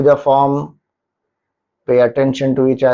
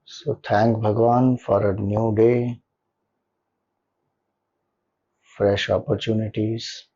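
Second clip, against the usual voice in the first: soft, lower meditation voice with long pauses; guided meditation; 4 semitones lower, 6 dB quieter than usual. Acted. Leader settling listeners into a comfortable posture and gratitude, guided meditation. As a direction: guide them gently into stillness, leaving long silences between phrases.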